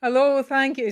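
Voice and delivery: usual speaking voice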